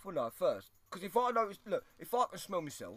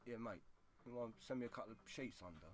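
soft, easy, matey ask; casual mate voice; 7 semitones lower, 15 dB quieter than usual. Retold or imitated. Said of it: "The delivery".

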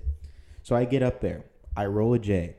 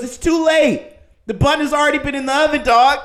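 high, loud gloating yell; mocking taunt; 13 dB louder than usual. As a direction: rub it in hard, laughing at him. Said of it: "he goes, he goes".

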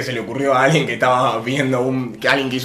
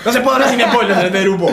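laughing complaint; 10 semitones higher, 8 dB louder than usual. Real, not acted. Complaining about spoilers, laughing.

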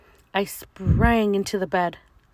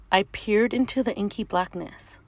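usual speaking voice

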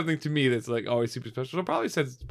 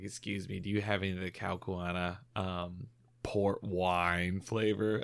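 quiet, slow creepy customer; drawn-out, skin-crawling request; 5 semitones lower, 9 dB quieter, slower than usual. Retold or imitated. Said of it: "he was like".

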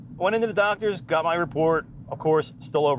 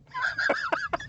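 high cackling laugh; 5 dB quieter than usual. Real, not acted. Laughing at the memory.

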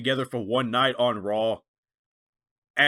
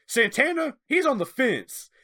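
mock quote; 8 semitones higher than usual. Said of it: "He goes".

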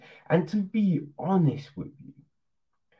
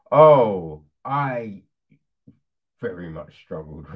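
normal talking voice

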